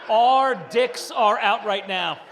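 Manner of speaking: loud, slow, laughing outrage; outraged shout. Real, not acted. Comic at the urinal, slow and loud in outrage. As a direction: spell it out, appalled and loud.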